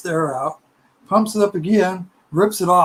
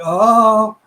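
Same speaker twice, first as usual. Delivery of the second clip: a sudden, loud, higher gasp of an oh; startled oh; 5 semitones higher, 9 dB louder than usual. Retold or imitated. Blacking out in formation.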